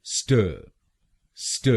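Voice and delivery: स्ट as a bare "st" cluster, no vowel; first syllable alone. Shown as a demonstration. Teacher isolates the first syllable for learners. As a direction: clip स्ट short with no vowel after it. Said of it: "पहले शब्दांश को यानी एसटीए हम बोलेंगे".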